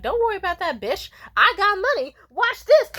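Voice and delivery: high, loud carefree brag; rich party girl. Retold or imitated. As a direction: show off, tossing money around. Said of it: "and she'd be like".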